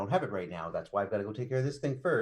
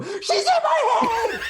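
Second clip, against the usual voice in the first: high, loud, drawn-out mock scream; panicked scream; 9 dB louder, much slower than usual. Rogue grabs his head at a strange woman's voice, hammed-up panicked scream. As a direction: shriek it in comic panic, stretching the words. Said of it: "grab my head and go".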